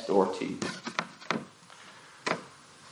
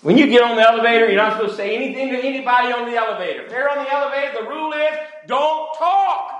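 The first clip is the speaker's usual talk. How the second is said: much higher, fast mock-scolding voice; mocking Brenda; 12 semitones higher, faster than usual. Preacher imitating Brenda scolding him about elevator rules, high and mocking. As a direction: parody her lecturing him. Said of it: "She's just like".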